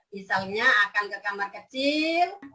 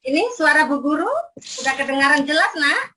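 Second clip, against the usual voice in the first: kindergarten teacher voice; 4 semitones higher than usual. Acted. Teacher checking class connection.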